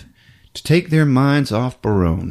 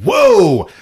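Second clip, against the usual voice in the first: mock alarmed shout; 5 dB louder, much slower than usual. Hosts gasp at the dead dogs.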